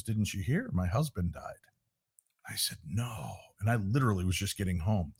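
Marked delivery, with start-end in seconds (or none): 0.0-3.3 soft, subdued re-enactment; hushed bad news, retold. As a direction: drop the voice for grave news. Retold news of neighbor's death.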